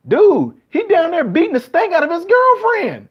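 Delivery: exasperated, as if the crowd can't see it; incredulous retort. Retold or imitated. Retorting about the real abuser.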